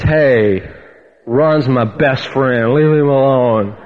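low, very slow put-on voice; imitated Bert. Bert standing up for his pastor, imitated slow low voice. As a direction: drag the words out low, defending a pal.

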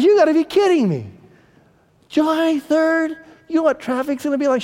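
mimicking wife. Husband imitating his wife's indignant reply, high and hammed-up. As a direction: play the wife in a squeaky, exaggerated imitation. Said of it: "She goes".

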